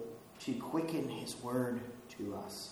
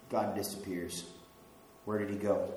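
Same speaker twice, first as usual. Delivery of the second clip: usual speaking voice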